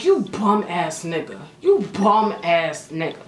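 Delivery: disgusted insult. Retold or imitated. Acted insult in story.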